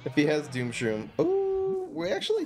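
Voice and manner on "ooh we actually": high squeal of excitement; ooh. Real, not acted. Streamer squealing at a good draw, excited.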